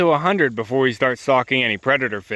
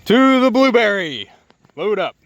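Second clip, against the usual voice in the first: higher, louder, slow call to dog; 6 semitones higher, 4 dB louder than usual. Real, not acted. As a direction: call the dog along brightly. Calling the dog along.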